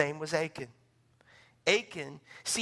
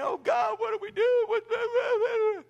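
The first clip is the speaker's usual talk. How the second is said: high loud desperate wailing; panicked prayer; 7 dB louder than usual. Retold or imitated. Leaders tearing clothes, wailing high and loud to God.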